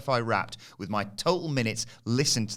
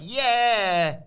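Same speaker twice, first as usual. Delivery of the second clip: high, loud, drawn-out yeah; imitated excited cheer; 10 semitones higher, 5 dB louder than usual. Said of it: "She was like".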